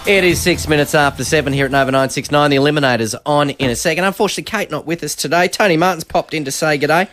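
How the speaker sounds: radio presenter read. Real, not acted. Host introducing the morning guest, upbeat radio presenter read. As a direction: announce it briskly over the music bed. Station time call over music.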